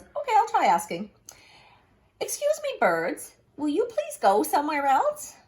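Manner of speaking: high, carefully polite request; polite Gerald. Acted. Gerald politely asks the birds to leave, high and careful. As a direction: ask very politely, voice up.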